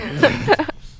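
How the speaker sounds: real giggling laugh; amused laugh. Real, not acted. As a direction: laugh at your own comeback. Laughs at own comeback.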